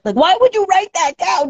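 high-pitched, incredulous complaint; mock indignation. Speaker acting out annoyance at a warning sign, indignant and high. Acted protest at a written warning.

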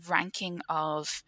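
usual speaking voice

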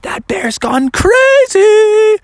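very high, loud, drawn-out cry; mock panic, stretching the words; hammed-up high shriek of alarm; alarmed camper. Alarmed campers react to the bear.

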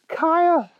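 high, urgent character voice; Elizabeth, alarmed. A narrator's reading. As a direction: call out to the hero in alarm. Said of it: "Elizabeth gasped".

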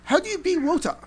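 high, loud mock-naive question to a fan; curious anime fan. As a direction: play a clueless newcomer asking eagerly. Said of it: "fan and say".

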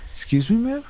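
high-pitched, incredulous question; retold disbelief. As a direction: shoot up high in mock-polite disbelief. Retold shock at mom's request.

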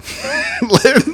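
short high burst of laughter; quick high laugh. Real, not acted. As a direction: crack up after the punchline. Amused by his own story.

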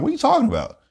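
high-pitched incredulous question; baffled retort. Retold or imitated. Retells his baffled reply at work.

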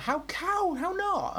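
high-pitched exasperated teacher; retold outburst, pitch shooting right up; exasperated shouting. Teacher retells losing it with pupils who can't speak, exasperated shouting. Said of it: "I just start shouting at them".

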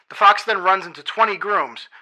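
ordinary delivery

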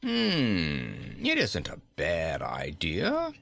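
slow, musing king; drawn-out, pondering theatrical voice; reflective. A narrator's reading. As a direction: mull it over with a long hum. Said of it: "said Gos reflectively".

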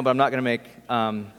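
ordinary talk, nothing marked

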